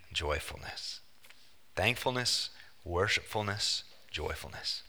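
near-whispered list of points; hushed. Acted. Preacher to note takers, whispering the points. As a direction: drop to a soft, breathy emphasis.

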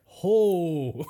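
sudden laughing "ho"; short delighted yelp; surprised hoot. Pretend.

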